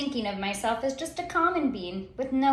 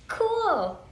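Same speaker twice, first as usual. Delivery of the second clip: mock impressed; 10 semitones higher than usual. A narrator's reading. Repeated admiring "cool.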